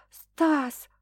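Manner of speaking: high female cry; girl crying out.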